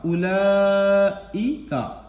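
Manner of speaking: उलाइका chanted very slowly with the ला drawn long and the वाव silent; recited slowly. Shown as a demonstration. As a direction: stretch ला in उलाइका like Quran recitation. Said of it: "चार से पाँच अलिफ के बराबर खींचकर".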